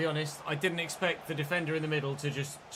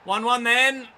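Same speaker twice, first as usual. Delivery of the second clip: much louder and higher outburst; frustrated shout; 8 semitones higher, 12 dB louder, slower than usual. Real, not acted. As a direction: yell at the screen in disbelief. Conceding a late equaliser.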